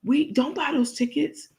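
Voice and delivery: high, sweet fake-earnest voice; lying Crook. Crook on camera claiming she tried, high fake sincerity. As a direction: put on a high innocent tone while lying. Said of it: "She said".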